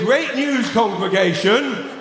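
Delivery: high-pitched, delighted announcement to a congregation; imagined churchgoer's excited good news; mock proud announcement. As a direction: announce a gift with naive, hammed-up pride.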